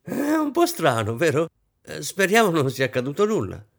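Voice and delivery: high, nervous attempt at lightness. A narrator's reading. Hostess to her guests after an awkward silence, nervous. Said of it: "rise nervosamente".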